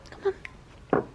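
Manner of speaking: ordinary delivery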